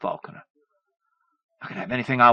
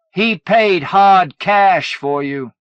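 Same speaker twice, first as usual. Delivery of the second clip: slow, loud and high; furious; 9 semitones higher, 10 dB louder, much slower than usual. A narrator's reading. Mother exposing the truth, furious and loud. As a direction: spit every word out.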